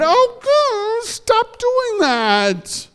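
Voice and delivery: put-on very high, slow, whining child; exasperated kid. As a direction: whine at mom to stop it. Boy exasperated at mother.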